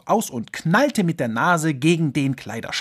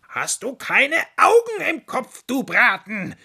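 much higher, louder furious shout; furious yell; 11 semitones higher, 4 dB louder than usual. Acted. Fox yelling at the rabbit after colliding. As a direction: scream at the clumsy rabbit.

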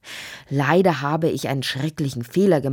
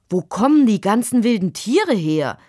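raised, louder questioning voice; demanding father; 5 semitones higher, 5 dB louder than usual. A narrator's reading. Father asks Lotti where the wild animals come from, demanding. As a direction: demand an explanation, pitched up.

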